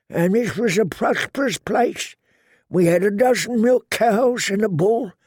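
put-on high-pitched old brother's voice; eldest brother. A narrator's reading. Eldest brown brother explains the war's toll, old man's voice. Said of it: "explained the eldest".

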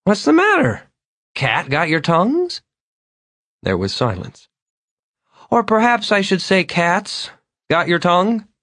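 tipsy visitor taunting the silent couple; strange teasing Vern. Acted. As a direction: tease them in a high odd voice. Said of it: "He smiled strangely".

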